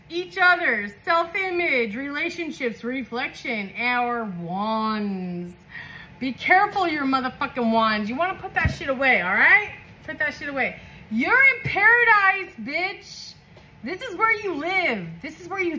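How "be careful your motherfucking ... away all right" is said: ordinary talk, nothing marked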